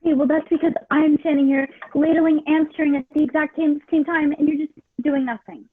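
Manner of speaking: loud, exasperated pushback; heated defensive outburst. Acted. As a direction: push back hard, fed up. Acted quarrel, defensive.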